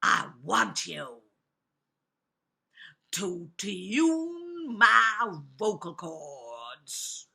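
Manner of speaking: very slow, loud wolf demand; big bad wolf. A narrator's reading. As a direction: drag each word out as the menacing wolf. Wolf demands his voice tuned.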